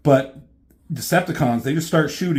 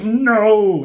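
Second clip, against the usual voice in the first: loud, higher shout of protest; desperate cry; 7 semitones higher, 7 dB louder than usual. Retold or imitated. Ironhide crawling to stop Megatron, loud desperate cry. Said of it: "And he's like".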